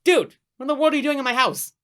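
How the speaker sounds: loud, high startled yell; startled shout. Acted. Host catching the visitor in his house, startled yell. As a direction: shout in shock at the intruder.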